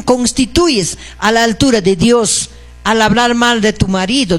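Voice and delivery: ordinary delivery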